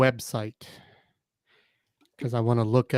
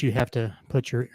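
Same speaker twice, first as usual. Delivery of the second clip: ordinary delivery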